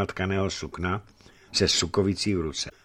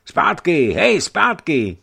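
loud high call, dragged out slowly; shouting at dogs; 8 semitones higher, 9 dB louder, much slower than usual. A narrator's reading. Young man shouting his dogs back, loud and high. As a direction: yell the dogs back across the clearing. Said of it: "křičel na psy".

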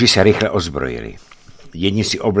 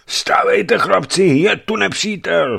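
a high, louder desperate alarm; trying to cry out; 13 semitones higher, 5 dB louder, slower than usual. A narrator's reading. The dying Savelij tries to warn his men, high desperate cry. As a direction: wake the men despite the wound. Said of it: "chtěl vykřiknout Savelij".

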